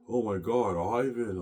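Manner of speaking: imitated crying wife. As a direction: lightly act out a wife crying out in distress. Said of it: "I was saying, she's crying".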